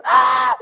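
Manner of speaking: sudden full-volume scream; high, startled shriek acted out; loud scream. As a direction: scream as loud as you can. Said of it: "I screamed as loud as I could".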